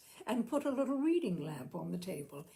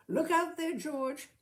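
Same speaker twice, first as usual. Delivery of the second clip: higher and louder playful warning; teasing warning; 8 semitones higher, 5 dB louder than usual. A narrator's reading. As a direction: tease George with a grin in the voice. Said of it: "joked with him".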